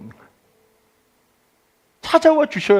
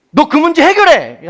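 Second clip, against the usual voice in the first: louder, higher, slow order; harsh command; 12 semitones higher, 8 dB louder than usual. Retold or imitated. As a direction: bark it like a demanding boss. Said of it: "하나님이 나한테 던져놓은 것 같은 거예요".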